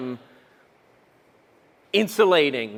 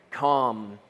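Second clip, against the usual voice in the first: ordinary talk, nothing marked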